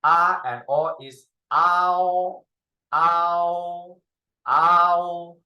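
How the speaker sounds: ow said clearly, repeated; model ao. Shown as a demonstration. Teacher drills the ao final for the class. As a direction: glide ah into ow. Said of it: "A is ah".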